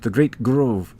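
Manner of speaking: put-on slow, halting Russian-accented elf. Elf recalls the Great Grove, slow Russian accent. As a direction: feel out each phrase in a Russian accent. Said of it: "It's a Russian accent".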